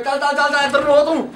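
rapid high-pitched repeated shooing; shooing cries. Retold or imitated. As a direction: mimic shooing cattle off the road. Mimes people shooing a cow away.

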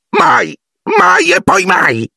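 very high, slow tearful refusal; wailing house-elf. A narrator's reading. House-elf refusing Harry's kindness, wailing very high. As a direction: cry it out in horror.

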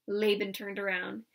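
usual speaking voice

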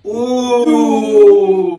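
high shriek. Acted. Sudden shriek.

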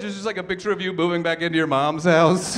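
ordinary talk

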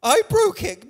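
high whiny mock voice of the guilty listener; lightly mocking the worried believer; mock self-blame. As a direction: imitate someone blaming themselves. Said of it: "you're going around feeling".

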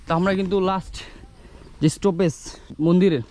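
ordinary talk

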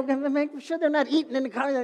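fussy instruction. Retold or imitated. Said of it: "I go".

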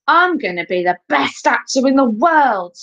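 high, eager boast. A narrator's reading. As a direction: crow it like a confident boy. Elvis boasts while bouncing his ball.

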